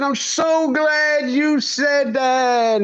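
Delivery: high, slow, drawn-out delight; a touch of a performed warm welcome; retold reply. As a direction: stretch it out like a pleased teacher. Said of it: "I said".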